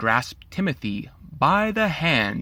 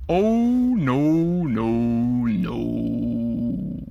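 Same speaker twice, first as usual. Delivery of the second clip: drawn-out, sleepy no's, the first one whispered; ever so slow; much slower than usual. Acted. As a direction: let each no drag like a ninety-year-old. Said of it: "said ever so slow".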